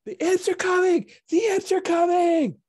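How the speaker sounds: put-on high, loud panicked kid's cry; shrill-pitched alarm cry of a little kid; calling out in alarm. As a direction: yell the warning like a frantic orphan. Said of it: "and he's calling out".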